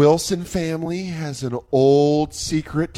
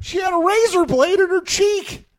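higher put-on old man voice; mock old man; 12 semitones higher than usual. Riffing on the video, mock fussy old man.

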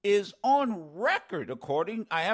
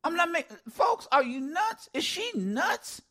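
incredulous; 9 semitones higher than usual. Real, not acted. Host incredulous at Greene.

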